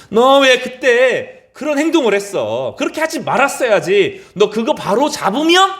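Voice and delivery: imagined scolding. Pretend. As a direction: scold like a disappointed master. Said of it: "라고 말씀하시지 않았어요".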